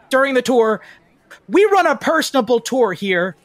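high, halting, nervously keen guide; flustered eager guide. Acted. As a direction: stumble eagerly through a high-pitched welcome. GM plays eager tour guide.

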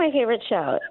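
usual speaking voice

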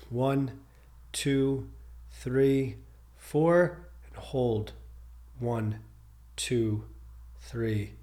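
soft, evenly paced count with pauses; guided breathing count. Real, not acted. Meditation guide leading listeners through box breathing, soft measured count. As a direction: calmly count each breath, leaving space between numbers.

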